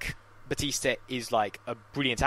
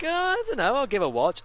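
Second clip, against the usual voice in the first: unsure dad; 12 semitones higher than usual. Retold or imitated. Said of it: "And he'll still be like".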